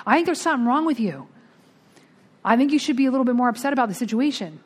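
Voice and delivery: high, fast, upset sponsor imitation; mock panic. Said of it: "She's in a panic".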